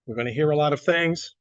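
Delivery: usual speaking voice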